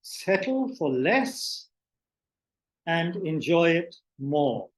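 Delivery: deliberate maxims with long pauses; guided meditation opening. Acted. Teacher settling listeners before an exercise, slow guided meditation. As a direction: give each short instruction room to sink in, slowly.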